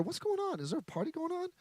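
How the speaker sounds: put-on puzzled older son. Older son hears music.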